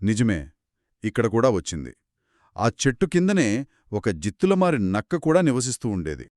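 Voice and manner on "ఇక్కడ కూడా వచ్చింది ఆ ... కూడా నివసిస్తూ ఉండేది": ordinary delivery, nothing marked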